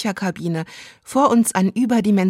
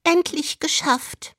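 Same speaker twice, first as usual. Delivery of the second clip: higher, slow sigh of relief; mock-dubbed housewife, drawn out; slow, high relieved sigh of a line; 8 semitones higher, slower than usual. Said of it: "sagt erstmal".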